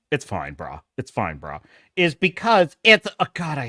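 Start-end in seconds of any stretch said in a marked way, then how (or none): 0.1-0.8 much lower, softer, lazy-bro voice; mock dude voice. Mocking the excuse. 1.0-1.6 much lower, softer, lazy-bro voice; mock dude voice. Mocking the excuse again.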